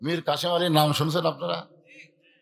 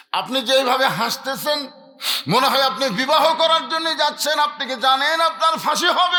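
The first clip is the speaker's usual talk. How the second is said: high, loud chanted mock lament; retold tearful plea; 11 semitones higher, 7 dB louder than usual. Preacher voicing the weeping lawyer to his client, chanted lament. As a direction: wail it in preacher's singsong, without real sobs. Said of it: "উকিল কান্দের বলে".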